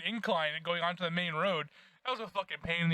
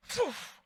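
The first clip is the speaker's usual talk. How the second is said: high quick mock "Poof!" pop; bungee pop sound; 11 semitones higher than usual. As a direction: pop it out like a snapping cord. Bungee snapping, imitated noise.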